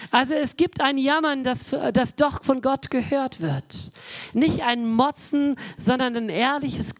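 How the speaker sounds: ordinary talk, nothing marked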